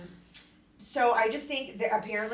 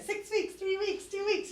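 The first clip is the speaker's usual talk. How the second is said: high mocking countdown; high voice imitating someone; mock quote; 12 semitones higher than usual. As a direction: squeak it out like a silly friend. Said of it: "else was like".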